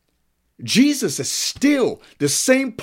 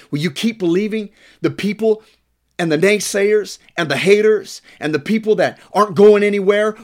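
higher-pitched, insistent sermon delivery; impassioned preaching; 5 semitones higher, 3 dB louder than usual. Real, not acted. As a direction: press the congregation with rising urgency.

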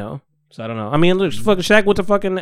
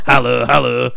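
[name] bark; 3 semitones lower than usual. Retold or imitated. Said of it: "There you go".

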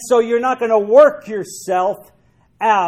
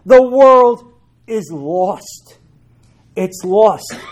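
slow proclamation; 5 dB louder, much slower than usual. Acted. Preaching point.

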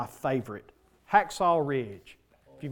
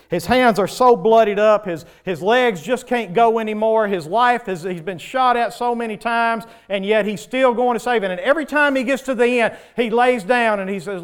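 loud, higher preaching at the story's climax; full-force preaching; 6 semitones higher, 9 dB louder than usual. Acted. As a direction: drive the story home to the church.

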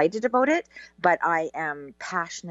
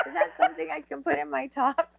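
giggly mock dilemma; 7 semitones higher than usual. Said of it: "I was like".

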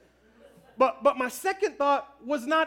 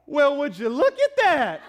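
high, slow, loud sassy drawl with a laugh; hammed-up hands-on-hips amazement; hands-on-hips exclamation; 4 semitones higher, 9 dB louder than usual. Husband answering his wife about the poster, sassy exclamation. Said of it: "I put my hands on my hips like this, and I went".

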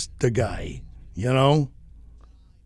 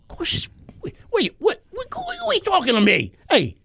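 gangster voice. Retold or imitated. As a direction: do a riled-up wiseguy. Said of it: "gangster voice in story".

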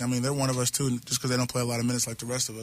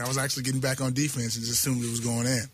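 ordinary delivery

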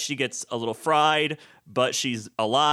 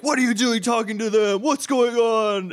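higher, louder confused shout; alarmed demand; 10 semitones higher, 5 dB louder than usual. Retold or imitated. Katniss confronting Haymitch and Finnick, mock alarmed shout. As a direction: yell at them in shock. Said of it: "She's like".

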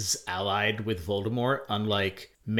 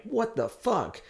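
high pitched shocked exclamation; stunned disbelief; 13 semitones higher, slower than usual. Retold or imitated. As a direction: react in sheer disbelief. Retelling shocked reaction.

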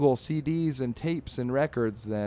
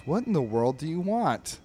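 higher, lightly acted exasperation; exasperated; 5 semitones higher, 3 dB louder than usual. Drabble turning round to his dog, exasperated. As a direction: swoop up on the question, fed up.

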